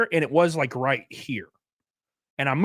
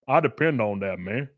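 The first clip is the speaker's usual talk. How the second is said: low, dopey mock voice of the friend, trailing off softer; mocking dumb friend; 7 semitones lower, 4 dB quieter than usual. Streamer mocks his friend's tax excuse, in a low dopey voice. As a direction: play him as a clueless guy defending his refund.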